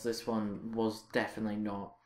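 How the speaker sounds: usual speaking voice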